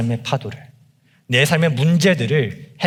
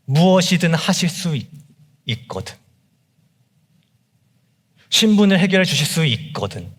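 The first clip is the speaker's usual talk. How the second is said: higher, quickening plea that keeps stalling on "if you can"; mock doubtful prayer; 5 semitones higher than usual. Preacher mimicking believers' doubtful prayer, pleading. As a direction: play a half-believing pray-er bargaining with Jesus. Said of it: "이런 기도를 많이 하죠".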